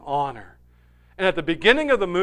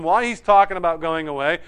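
ordinary talk

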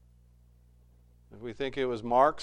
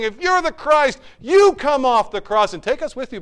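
higher and louder taunting shout; mock yell; 11 semitones higher, 12 dB louder than usual. Thief on the cross jeering at Jesus, shouting. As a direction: jeer at him from the cross.

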